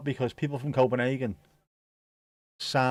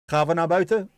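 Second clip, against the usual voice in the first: higher and louder, cheery dog-walk call; louder, higher sing-along call to the dogs; excited call to the dogs; 5 semitones higher, 6 dB louder than usual. Real, not acted. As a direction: chirp it at the dogs like a treat is coming. Talking to the dogs going out.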